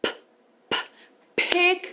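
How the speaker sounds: a small puffed p, on its own; p, p popped lightly; isolated p sound. Shown as a demonstration. Teacher isolating the p sound for learners. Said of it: "O som do P aqui".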